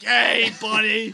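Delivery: loud, slow drawn-out greeting; hammed-up smoker's hey; smoking mascot. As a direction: holler it like a seedy costumed bunny. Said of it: "You're, like, smoking a cigarette".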